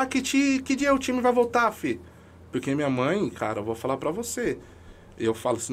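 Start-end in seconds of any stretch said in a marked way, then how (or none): 0.0-2.0 higher, lightly voiced pleading mother; imitating mother. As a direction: echo a mother's hopeful question, pitched up. Imitating mother asking about team.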